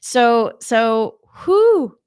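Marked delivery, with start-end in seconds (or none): 1.4-2.0 high, loud "whoo" of release; emotional whoo. Real, not acted. Pausing in an emotional confession, a real high whoo. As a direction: let out a big high whoo to shake off emotion.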